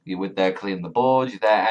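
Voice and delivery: ordinary talk, nothing marked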